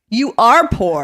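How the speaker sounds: slow, loud, emphatic mock dad retort; Bill Cosby route. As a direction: play a father bluntly putting a child down. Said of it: "gone the Bill Cosby route".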